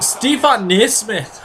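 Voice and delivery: badly faked Scottish accent; horrible Scottish accent. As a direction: attempt a Scottish accent on the name, clumsily. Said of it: "That was a horrible Scottish accent".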